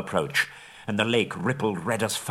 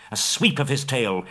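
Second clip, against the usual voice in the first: plain reading voice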